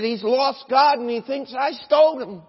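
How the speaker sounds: put-on high, loud child's outburst; little boy's alarm. Boy tells his mother about the preacher, alarmed outburst.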